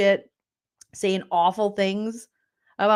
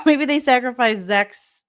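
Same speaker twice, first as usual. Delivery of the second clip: ordinary talk, nothing marked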